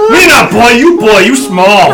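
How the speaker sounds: caveman retort. Acted. Half-orc player retorts.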